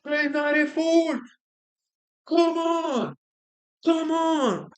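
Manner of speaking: yelling at the screen in excitement; excited shout. Real, not acted. Urging player in live game.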